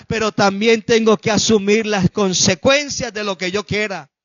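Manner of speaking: loud, driving sermon delivery, each phrase hammered; fiery preaching. Acted. Preacher warning the congregation against defying God, loud fiery preaching.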